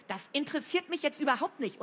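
high, sharp cut-in; sharp interruption. A narrator's reading. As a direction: cut her off impatiently. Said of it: "unterbrach Flo".

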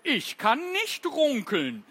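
a sad beet lamenting; betrübt. Acted. The beet sadly admits it cannot runkeln. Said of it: "sagte die Runkelrübe betrübt".

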